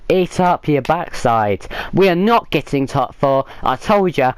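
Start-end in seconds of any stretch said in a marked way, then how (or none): none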